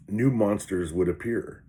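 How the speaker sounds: ordinary talk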